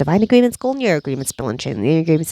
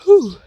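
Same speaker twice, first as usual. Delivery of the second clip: loud exhale; 12 semitones higher, 7 dB louder than usual. Acted. Release after clearing.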